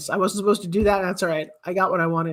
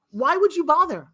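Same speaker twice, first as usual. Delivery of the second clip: normal talking voice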